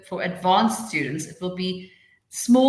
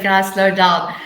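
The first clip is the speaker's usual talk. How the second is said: louder, mock call to slow down; quoted request; 9 dB louder, slower than usual. Teacher quoting students' complaint, louder quoted request. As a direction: relay the request with more volume. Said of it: "someone said".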